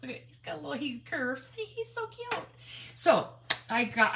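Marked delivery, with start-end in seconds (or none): 1.6-2.4 1 cooing. Real, not acted. Cooing over tiny gourd.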